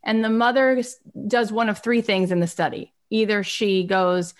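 normal talking voice

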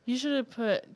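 ordinary talk

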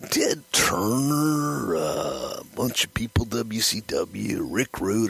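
slow, drawn-out wrestler impression; Randy Savage. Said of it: "Randy gets this look on his face like".

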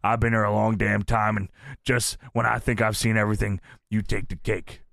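low-pitched wrestler promo; lightly imitated, low and deliberate; Taker. Wrestler on the mic confronting his boss, low voice. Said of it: "And Taker says".

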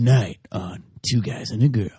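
low, put-on network announcer. Co-host introducing the show, low announcer voice. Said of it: "You should be the new announcer".

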